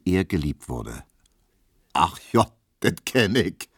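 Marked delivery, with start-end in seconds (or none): 1.9-3.7 a knowing, amused chuckle in the voice; you've seen this trick before; laughing knowingly. A narrator's reading. Tesch laughs at Emil's love card, knowing. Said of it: "sagte Herr Tesch lachend".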